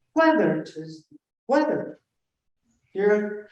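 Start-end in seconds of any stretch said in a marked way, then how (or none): 0.1-2.0 WHETH-er, first syllable punched high and loud; stress on first syllable. Shown as a demonstration. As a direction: hit the "wheth" of "whether" hard. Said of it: "putting the accent on that first".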